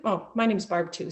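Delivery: ordinary talk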